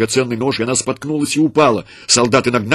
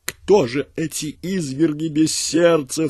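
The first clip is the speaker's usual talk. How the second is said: higher, slow, horrified cry; frantic outcry; 6 semitones higher, slower than usual. A narrator's reading. Frantic mother crying out at the soldier, high and horrified. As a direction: cry out half mad with horror. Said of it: "вскрикнула обезумевшая женщина".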